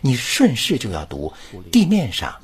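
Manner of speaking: ordinary talk, nothing marked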